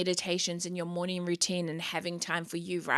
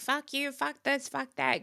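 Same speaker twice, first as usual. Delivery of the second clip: mock road rage; 7 semitones higher than usual. Imagined driver yelling at people on the road, mock road rage. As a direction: imitate a driver cursing at people. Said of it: "having road rage and yelling at people".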